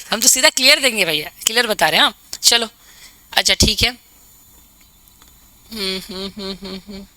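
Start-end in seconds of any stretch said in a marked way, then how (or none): 5.7-7.1 low-volume hums, one after another; quiet humming. Real, not acted. Teacher pausing between points, quiet absent hums. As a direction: hum very softly to yourself.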